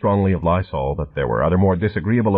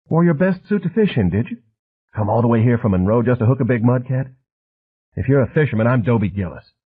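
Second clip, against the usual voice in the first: higher, mocking questions; sarcastic taunting; 4 semitones higher than usual. A narrator's reading. Harvard man mocking Flint's suit and story, sarcastic. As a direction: needle him with sarcasm. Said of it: "soft, almost feminine voice".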